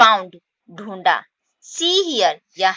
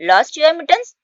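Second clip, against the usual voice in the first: higher, louder scolding; scolding cat; 8 semitones higher, 5 dB louder, much slower than usual. Acted. Mother cat scolds the kittens, higher and louder. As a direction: raise the voice at the kittens. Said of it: "फिर cat उन्हें डांटती है".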